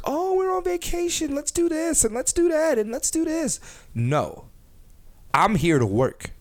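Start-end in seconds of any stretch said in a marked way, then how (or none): 0.0-3.6 put-on high airy ditzy girl voice, much higher; mock an excited girl on vacation; mocking girlfriend. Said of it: "some girls get to the city and be like".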